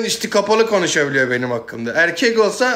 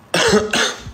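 chesty cough. Real, not acted. Sick streamer coughing.

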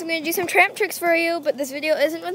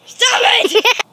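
loud high protest; shouting; 7 semitones higher, 5 dB louder than usual. Real, not acted. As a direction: yell it out laughing. Louder protest.

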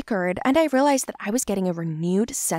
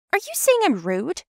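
high, offended question; indignant; 10 semitones higher than usual. Acted. Daughter challenging her mother's warning, high and indignant. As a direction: take offence, pitch jumping up.

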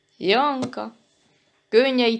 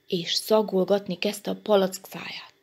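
usual speaking voice

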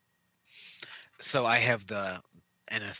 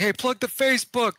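high mock call; 11 semitones higher, 5 dB louder than usual. Retelling himself at table.

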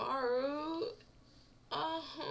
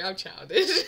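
ordinary talk

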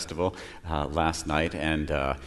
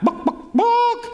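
high squawky chicken book; 4 dB louder, slower than usual. Acted. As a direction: cluck it like a hen asking. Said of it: "the chicken's back".

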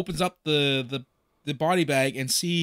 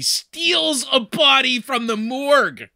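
higher, louder, slow drawn-out disbelief; incredulous exclamation; 8 semitones higher, 6 dB louder, slower than usual. Real, not acted. Telling co-host the absurd plot, incredulous. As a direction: stretch it out in amazed outrage. Incredulous punchline of retold scene.

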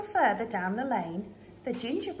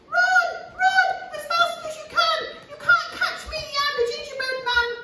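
very high, loud, quick taunt; squeaky cheeky runaway; gingerbread man voice; 5 dB louder, faster than usual. Acted. Cookie taunting again.

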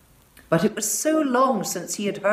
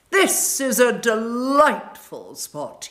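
slow, higher, loud windy howl; north wind voice; 6 semitones higher, 6 dB louder, slower than usual. A narrator's reading. North wind praising the frozen garden, slow and howling. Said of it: "he said".

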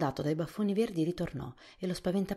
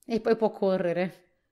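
laughing; 5 semitones higher, 8 dB louder, slower than usual. A narrator's reading. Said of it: "dichiarò Tip ridendo".

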